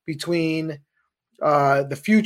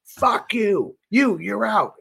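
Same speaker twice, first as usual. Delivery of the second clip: higher-pitched mock dismissal; retold curse, pitched up but not shouted; imitated angry trader; 6 semitones higher, slower than usual. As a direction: lightly act out a trader cutting someone off. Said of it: "the guy from Deutsche goes".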